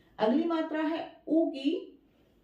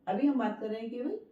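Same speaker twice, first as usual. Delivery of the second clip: ordinary talk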